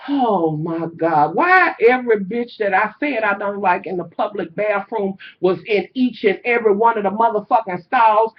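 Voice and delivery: usual speaking voice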